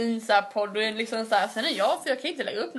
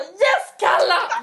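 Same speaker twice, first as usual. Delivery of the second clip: high, loud cheer for the skier crossing the line; cheering shout; 8 semitones higher, 8 dB louder, much slower than usual. Retold or imitated. Cheering a skier on TV with a high, loud yell. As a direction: yell it like a fan jumping off the sofa. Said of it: "står upp och skriker".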